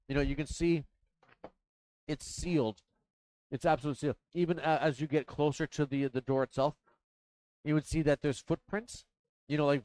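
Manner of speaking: normal talking voice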